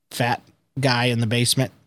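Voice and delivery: usual speaking voice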